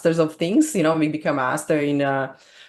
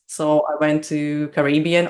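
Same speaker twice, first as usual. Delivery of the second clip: ordinary talk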